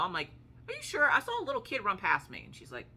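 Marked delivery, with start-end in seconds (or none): 0.7-2.4 high-pitched incredulous questioning; retold disbelieving insistence; insistent disbelief. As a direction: press the question, pitch jumping right up. Retold insisting to doubting friend.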